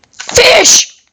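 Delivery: sudden high shouted exclamation; excited shout. Real, not acted. Player calling out a catch, excited shout. Calls out in the game.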